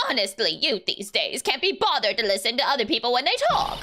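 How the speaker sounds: put-on scolding old biddy voice; old bag's nagging character voice; crotchety old lady. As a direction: grumble about the young as a crotchety old woman. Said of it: "she, she talks like this".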